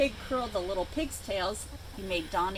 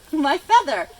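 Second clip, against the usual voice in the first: loud, high wail of loss; squawking; 5 semitones higher, 9 dB louder than usual. A narrator's reading. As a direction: squawk out in dismay. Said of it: "squawked Chicken Chuck".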